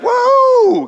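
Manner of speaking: high, loud whoop of delight; excited whoa. Retold or imitated. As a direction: shout it like a thrilled little boy.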